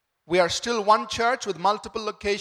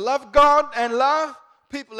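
much louder, higher and slow, hammering each word; emphatic proclamation; 6 semitones higher, 10 dB louder than usual. Real, not acted. Pastor urging the congregation, slow and emphatic at full force. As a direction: proclaim it to the whole room. Pastor drives home core value.

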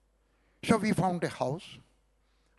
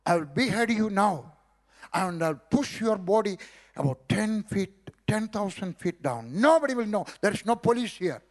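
loud, raised, threatening voice; angry threat; 4 semitones higher, 4 dB louder than usual. Retold or imitated. The angry sadhu threatens the preacher, loud and furious. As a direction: bellow the threat with the axe raised. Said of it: "He was angry".

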